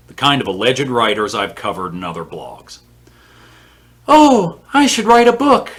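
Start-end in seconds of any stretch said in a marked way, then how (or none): 4.1-5.7 high, loud, hammed-up poser voice; mock naive wannabe. Imitating posers deciding to become writers, mock naive. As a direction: mimic a clueless would-be writer, pitched way up.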